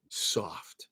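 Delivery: whispered soft, drawn out; hushed whisper savouring the leather. Real, not acted. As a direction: breathe the word like a caress. Praising buttery leather.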